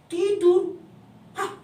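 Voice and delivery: astonished villagers doubting Jesus; shocked disbelief. Acted. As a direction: gasp out the word in disbelief. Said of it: "orang di sana terkejut mendengar".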